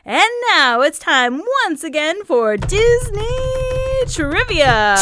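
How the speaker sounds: slow, loud game-show intro; announcer voice. Acted. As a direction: announce the segment like a host.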